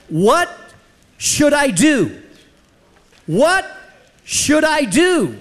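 urgent self-questioning. Acted. Preacher acting out a question asked in difficulty, urgent. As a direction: ask it urgently, pitch lifted. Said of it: "at a moment in urgency".

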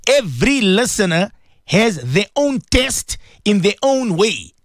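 normal talking voice

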